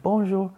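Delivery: 法语问候; French greeting. Retold or imitated. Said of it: "they're like".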